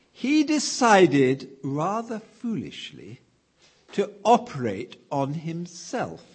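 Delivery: ordinary talk, nothing marked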